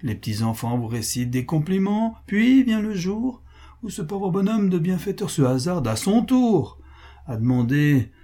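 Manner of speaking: high, singsong mock flattery with falling ends; sardonic Pascal. Pascal mocking those who flatter then ask favours, sardonic. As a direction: parade each compliment with exaggerated sweetness.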